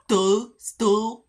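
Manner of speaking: loud, high dopey mock voice; mocking the neighbor. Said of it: "Kinda like when he goes".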